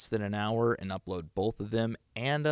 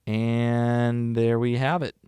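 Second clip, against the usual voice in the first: triumphant; 7 dB louder, slower than usual. Real, not acted. Triumphant slow finish.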